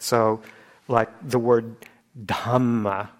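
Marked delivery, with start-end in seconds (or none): 2.2-3.1 Dhamma with a big puff of air after the D; Dhamma, strongly aspirated D. Shown as a demonstration. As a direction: exaggerate the breath on Dhamma. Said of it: "I'm exaggerating the aspiration just so you can hear it".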